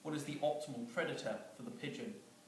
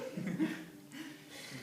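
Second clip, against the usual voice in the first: breathy giggle; 6 semitones higher than usual. Real, not acted. Teller cracks up.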